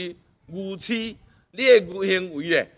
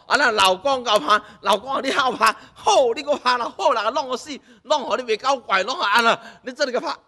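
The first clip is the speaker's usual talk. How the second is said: playful higher scolding as the wife, hitting with a pillow; mock wife scolding husband; 7 semitones higher than usual. Wife playfully scolding her husband while beating a pillow. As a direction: scold him half-jokingly while you hit.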